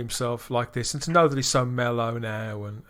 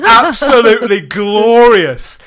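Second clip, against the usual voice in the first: slow, much louder, savouring every word; gushing praise; 7 semitones higher, 11 dB louder, slower than usual. Real, not acted. Host raving to his co-hosts about the story, slow and loud. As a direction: stretch it out like it's the best thing you've heard.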